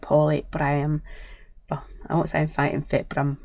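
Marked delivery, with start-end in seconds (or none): none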